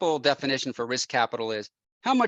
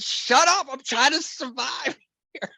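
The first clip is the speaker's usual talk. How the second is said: panicked snap; 10 semitones higher than usual. Retold or imitated. Narrator snapping at his wife on the phone, high laughing panic. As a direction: cut her off in giddy panic.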